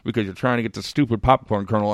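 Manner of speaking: words mangled by a finger digging at the teeth; talking while picking teeth. Shown as a demonstration. Ranting to his co-host about popcorn, talking through a finger in his mouth. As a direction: talk like you're prying a kernel out of your molars. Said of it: "you start talking like this".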